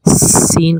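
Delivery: سین with a long whistled hiss on the s; whistling letter. Shown as a demonstration. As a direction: draw out a sharp sibilant at the start of سین. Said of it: "سیٹی کی آواز نکال کے".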